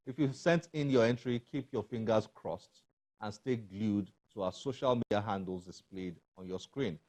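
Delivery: ordinary talk, nothing marked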